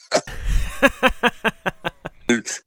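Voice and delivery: high, breathless burst of laughter; big laugh. Real, not acted. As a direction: cracks up at his own story. Laughing at his own memory.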